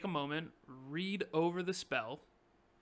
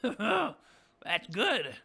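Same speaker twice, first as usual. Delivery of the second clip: higher goblin voice broken by cackles; laughing goblin boss; 3 semitones higher, 6 dB louder than usual. Acted. Goblin boss seeing a severed head, gleeful cackling. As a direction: cackle through the words. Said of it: "Yimmick sees the head, and he says".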